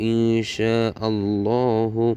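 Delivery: slow, measured reciting with stretched vowels; dua recitation. Shown as a demonstration. Teacher models the joined phrase for learners, slow recitation. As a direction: join the syllables into a drawn-out recitation.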